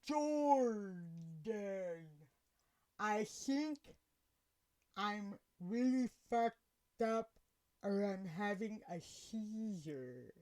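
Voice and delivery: very slow, stretched words with long gaps; very buzzed slurring. Retold or imitated. Buzzed woman tells the driver something's wrong, slow mock slur. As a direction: imitate being too buzzed to move your mouth. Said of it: "That's exactly how I sounded".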